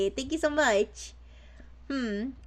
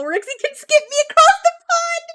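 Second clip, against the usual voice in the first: very high, loud mock excitement; playful squeal; 8 dB louder than usual. Playfully reads chat's pebble joke aloud.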